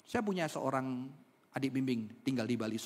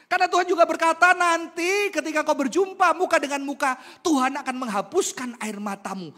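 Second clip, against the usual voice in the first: raised, ringing promise to the flock; loud preaching; 12 semitones higher, 10 dB louder than usual. Acted. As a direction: proclaim it loudly. Preaching at full force.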